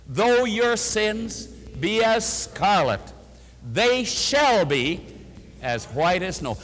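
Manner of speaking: slow, measured scripture recital; slow recitation. Shown as a demonstration. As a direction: lead the congregation through it slowly. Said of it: "let's quote it together".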